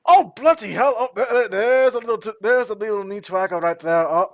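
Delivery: loud, high-pitched blustering yelp; startled dwarf. Acted. Dwarf just kicked in the shin, startled and blustering. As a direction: stammer in indignant surprise, high and loud.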